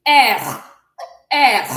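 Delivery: loud R rolled from the throat; French throat R. Shown as a demonstration. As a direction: push the R out from the throat like clearing a cough. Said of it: "from your throat".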